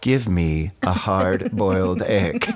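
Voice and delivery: slow, deliberate, laughing through it; slow record voice breaking into laughter; language tape line. Retold or imitated. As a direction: recite it slowly like the record, laughing. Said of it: "And I go".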